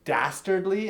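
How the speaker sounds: ordinary talk, nothing marked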